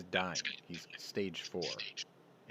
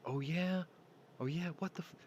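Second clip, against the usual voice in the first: high-pitched mock challenge; confrontational; 10 semitones higher than usual. Woman arguing with a belligerent guy, defiant. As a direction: imitate her squaring up, voice pitched way up. Retold armed confrontation with man.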